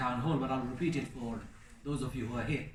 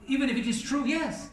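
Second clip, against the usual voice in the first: higher, rising imitation of an objector's even if it is true; mock objector; 10 semitones higher, 4 dB louder than usual. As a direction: lightly voice a questioner pushing back. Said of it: "Somebody said to me".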